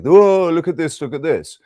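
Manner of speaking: mock alarm. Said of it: "telling everybody".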